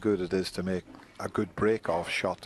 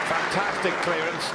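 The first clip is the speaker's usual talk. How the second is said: much higher, louder, thrilled; excited commentary; 10 semitones higher, 5 dB louder than usual. Real, not acted. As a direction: let the crowd hear your thrill. Commentator hails the big clearance.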